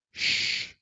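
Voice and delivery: a quick breathy shh to hush the room; hushing whisper. Pretend. Husband hushing viewers so his wife won't hear, whispered shh.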